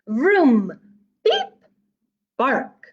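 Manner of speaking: loud vroom, beep, squeaky high bark; truck puppy noises. Acted. Reader voicing the returning puppy truck loudly. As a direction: rev up then yip like a puppy.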